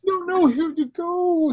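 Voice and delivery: high, slow sly salesman; sly shopkeeper voice. Retold or imitated. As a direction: play the falsely generous shopkeeper. Voicing Tom Nook selling.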